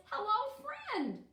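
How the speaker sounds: high-pitched welcoming hello; cheerful storybook greeting; friendly Bear. Acted. Bear greets new friend Owl.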